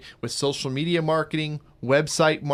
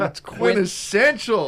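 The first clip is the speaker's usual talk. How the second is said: mock posh; 7 semitones higher, 5 dB louder, slower than usual. Savouring the word.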